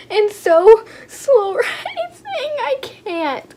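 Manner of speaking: high squeal breaking into a laugh; overwhelmed with delight. Real, not acted. Vlogger squeezing an airy slow rising squishy, overwhelmed. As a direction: squeal it, too giddy to finish. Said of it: "I can't even right now".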